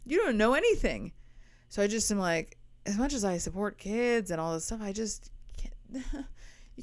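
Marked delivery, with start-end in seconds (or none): 4.8-6.8 breathy half-whispered aside; hushed, almost voiceless. Real, not acted. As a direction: drop to a whisper for the confession.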